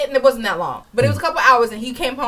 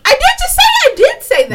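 loud, high-pitched insistence; shouted protest; 6 dB louder than usual. Real, not acted. Partner insisting to him that she admitted it, shouted protest. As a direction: push back hard, voice shooting up. Protesting in live quarrel over accountability.

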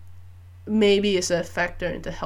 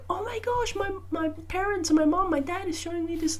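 put-on high, softer voice of an awed child; amazed little kid; 10 semitones higher, 4 dB quieter than usual. Kid amazed at what parents show, high child voice. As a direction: imitate a delighted kid, pitched up and hushed. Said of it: "So the kid will be like".

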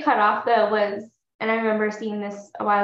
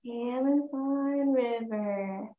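slow, droning reply, much softer; mock child chorus; 7 dB quieter, much slower than usual. Co-host echoes the guide as a dull child chorus, slow and soft. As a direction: drag it out like bored kids repeating.

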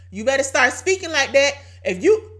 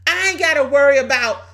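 usual speaking voice

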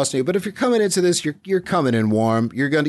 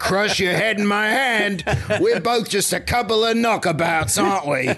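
higher, jokey Cockney voice; Cockney accent; 5 semitones higher than usual. Acted. Wrecker bonding with a kid, jokey Cockney accent. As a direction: play a chummy Cockney lug. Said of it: "why did he turn into Cockney".

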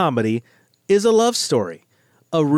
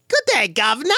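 high, hammed-up Cockney greeting; cartoonish British accent; 6 semitones higher than usual. Shown as a demonstration. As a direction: overdo it like a cartoon Brit, pitched up. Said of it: "the only British accent I can do".

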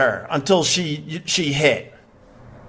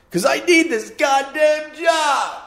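mock outburst; 9 semitones higher than usual. Mock outburst then denial.

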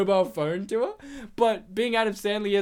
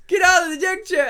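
high, loud, quick order; mock angry shout; exasperated shout; 9 semitones higher, 7 dB louder, faster than usual. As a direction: yell it like a fed-up director. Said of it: "He'll be like".

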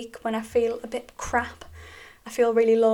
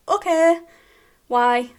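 brisk doctor voice; 6 semitones higher, 8 dB louder, slower than usual. Retold or imitated. Doctor voiced in retold call.

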